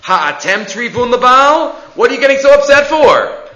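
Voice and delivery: high, loud mocking comeback; sarcastic retort. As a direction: needle them for their fuss. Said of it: "being a little sarcastic".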